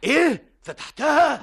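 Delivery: sharp high startled cry; shocked disbelief. Acted. Boss shocked at his helper's confession, startled cry. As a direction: jolt up in alarm at the news. Learns the bag was opened.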